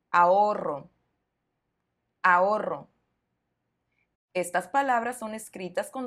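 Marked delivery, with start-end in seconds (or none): 0.1-2.9 "ahorro" with a long trilled rr; teacher's model "ahorro", the middle R strongly trilled; strong double R. Shown as a demonstration. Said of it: "doble R para enfatizar".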